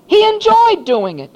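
high, loud jubilant preaching; loud, high exultant sermon; full-force preaching. Acted. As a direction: lift the whole room with it.

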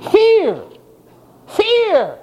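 high shouted Fear!, falling hard. Acted. Preacher cries out Fear! to the congregation. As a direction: cry out Fear! like sudden terror. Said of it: "where we get our word phobia".

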